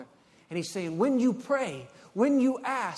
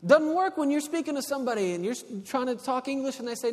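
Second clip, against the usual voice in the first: usual speaking voice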